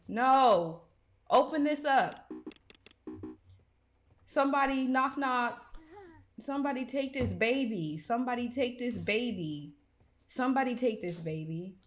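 loud pleading calls for help; desperate shout. Real, not acted. Gamer pleading for someone to take the baby. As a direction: beg someone to take him.

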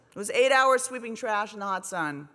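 ordinary talk, nothing marked

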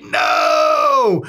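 a high, loud, hammed-up "No!"; a hammed-up howl of horror; mock horrified shout. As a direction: scream it in fake despair. Horrified reaction to dad's clicking.

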